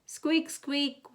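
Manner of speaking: high squeaking creak; squeaky lantern. A narrator's reading. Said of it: "went the lanterns".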